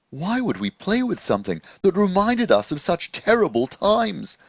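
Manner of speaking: a gentle magical scarecrow asking; eager young scarecrow wondering aloud; Jaffa the scarecrow. Acted.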